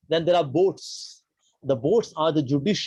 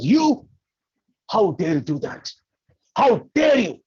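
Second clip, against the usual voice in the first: high, loud indignant outburst; thundering challenge to an offender; acted outrage; 4 semitones higher, 4 dB louder than usual. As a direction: explode on the first word, then again at the end. Acted Parshuram outraged at Ram's confession.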